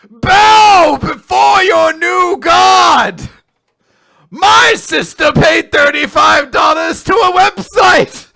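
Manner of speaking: building to a slow, high, roaring shout; megalomaniac rant. Pretend. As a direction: rant like a mad self-crowned king. Escalating lordly rant, shouting.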